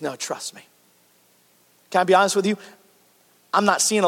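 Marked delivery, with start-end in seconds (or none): 0.0-0.7 much softer and lower, calm; gentle reassurance. Retold or imitated. Jesus replying to the pastor, soft and calm. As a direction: quietly settle a doubter. Jesus answering the speaker's complaint.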